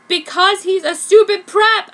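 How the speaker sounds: high, loud furious retort; furiously. A narrator's reading. Heroine answers Kishu about Aoyama, furious. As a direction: spit the insult out loud. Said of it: "I said so furiously".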